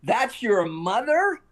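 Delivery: high, drawn-out mock shout; retold bellow. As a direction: imitate an incredulous holler, slow and rising. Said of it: "at the top of his lungs".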